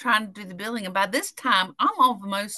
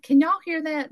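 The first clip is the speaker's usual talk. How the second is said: retelling your own higher-pitched question; retold question; 8 semitones higher than usual. Asking coworkers about a noise, higher retold question. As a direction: ask it higher, as if checking with others. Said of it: "And I'm like".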